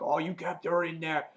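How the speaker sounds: lightly hammed-up angry outburst; mock accusing yell. Shane accuses Herschel in an argument, mock angry yell. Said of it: "they're yelling at each other".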